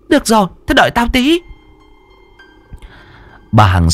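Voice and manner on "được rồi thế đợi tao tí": put-on high, fast older neighbour; quick high-pitched busybody agreeing; older woman. A narrator's reading. Older neighbour agrees to fetch the healer, high quick voice.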